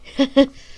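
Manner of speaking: quick bright giggle, a bit loud; short laugh. Acted. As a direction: laugh it off lightly. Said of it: "She laughed".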